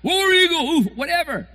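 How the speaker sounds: high, loud half-joking cheer; mock fan cheer. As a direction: toss off a fan chant then shrug. Imitating another team's fans.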